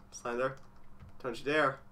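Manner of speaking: low, soft caution; quiet warning. Real, not acted. Player warning Slender not to appear, quiet and low. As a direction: warn him off under your breath.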